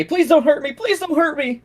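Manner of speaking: high, terrified pleading cry; frightened shriek. Acted. Cowering man shrieks at the adventurers, terrified begging. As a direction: beg in panic, voice shooting up. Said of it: "shriek when you call".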